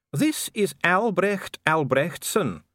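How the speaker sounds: slow, high-voiced dwarf official. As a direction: introduce him slowly and formally, high-pitched. Dee introduces the dwarf.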